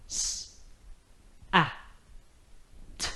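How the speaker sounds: soft separate letter sounds; s, a, t each alone; sounding out words. Shown as a demonstration. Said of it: "Let's do it again".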